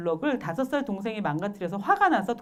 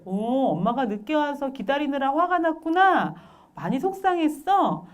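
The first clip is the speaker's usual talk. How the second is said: higher-pitched, sympathetic parent voice; acted empathy; 6 semitones higher than usual. Mother who came late to child, empathizing. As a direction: soothe an upset child, drawing the words out.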